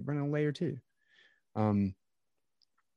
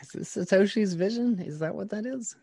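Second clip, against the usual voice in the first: much higher, sarcastic falsetto; mock BSV believer; 12 semitones higher than usual. Mocking the BSV crowd's Satoshi claims in a high sarcastic falsetto. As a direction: squeak it out like a mock true believer.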